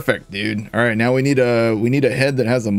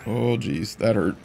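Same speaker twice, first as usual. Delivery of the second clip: usual speaking voice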